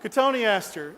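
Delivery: real amused laughter in the voice; laughing. Real, not acted. Amused after granddad story.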